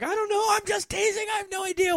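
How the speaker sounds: very high-pitched mock protest; joking friend. Friend Erica excusing her teasing, very high mock protest. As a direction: imitate the friend backpedaling in a high squeal. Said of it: "our friend Erica was joking around".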